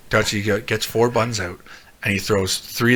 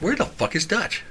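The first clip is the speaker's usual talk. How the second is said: higher-pitched, softer puzzled wondering; baffled; 10 semitones higher, 5 dB quieter than usual. Retold or imitated. Friend noticing Dutch is missing, baffled. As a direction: wonder where your friend has got to.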